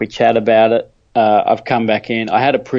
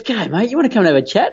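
upbeat doctor; 10 semitones higher, faster than usual. Retold or imitated. Retold upbeat doctor greeting.

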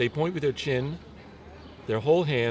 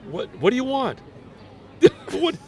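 high, startled what do you want; higher pitched mock indignation; annoyed local; 12 semitones higher than usual. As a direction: play a baffled local at a stranger's palm. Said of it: "they'll say".

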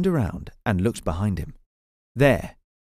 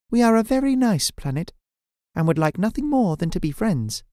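high, lilting alien voice; sweet alien speaking in the mind; friendly alien voice; 8 semitones higher than usual. Acted. Alien speaks in his head.

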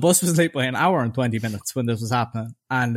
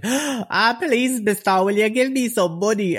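high, pleading wail of a beggar; tearful begging woman; 9 semitones higher than usual. Retold or imitated. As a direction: plead in a high, put-on whine. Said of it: "kept crying about her child".

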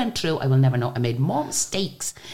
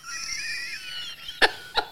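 high, wheezy laugh; helpless laugh; 8 dB quieter than usual. Real, not acted. Singer cracks up at her own story, helpless laugh.

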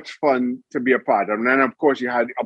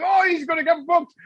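high-pitched excited crowd chatter; eager mock fan voice, much higher. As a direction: imitate thrilled fans, pitch jumping way up. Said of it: "The fans were all excited".

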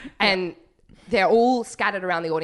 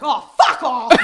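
loud, raised outburst breaking into laughter; overwhelmed disbelief; 5 semitones higher, 7 dB louder, slower than usual. Retold or imitated. Teller reliving her astonishment at the magician's trick, delighted outburst. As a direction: burst out with it, astounded. Retells her reaction, losing her mind.

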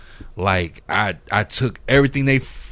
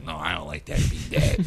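put-on lower, flat little-boy verdict; a hint of a bored kid; lightly voiced son; 8 semitones lower, 3 dB quieter than usual. Son telling dad his beat is bad, low flat kid voice.

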